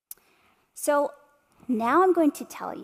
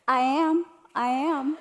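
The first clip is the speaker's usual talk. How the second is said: higher and louder, surprised "I am, I am"; shocked reply; 4 semitones higher, 4 dB louder, slower than usual. Retold or imitated. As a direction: blurt it out as if caught off guard. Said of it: "Shocked, she's like".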